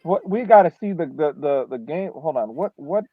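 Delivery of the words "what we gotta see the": ordinary talk, nothing marked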